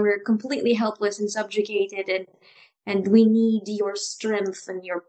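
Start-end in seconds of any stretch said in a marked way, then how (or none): none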